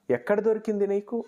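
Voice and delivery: high-pitched, astonished question; surprised. A narrator's reading. Grandmother asks about the found gold chain, surprised. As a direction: ask it with mild narrated amazement, pitch up. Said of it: "party ఆశ్చర్యంతో అడిగింది".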